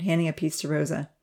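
usual speaking voice

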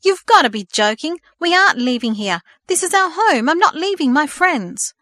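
very loud, high angry outburst. Acted. Son refuses to leave his friends, furious outburst. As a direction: shout it out in protest. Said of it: "Thomas burst out".

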